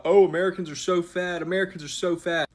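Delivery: lightly hammed-up snooty European; teasing mockery of smug Europeans; mocking snooty European. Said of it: "talking about".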